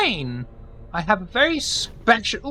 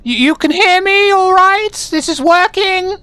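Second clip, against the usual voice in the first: loud, higher voice to be heard; 7 semitones higher, 4 dB louder than usual. Acted. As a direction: raise your voice for a faint phone. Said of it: "You don't have to yell".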